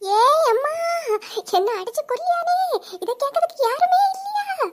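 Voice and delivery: panicked wail. Acted. Wife fleeing her husband, begging anyone, panicked wail. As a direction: cry out to anyone who will listen.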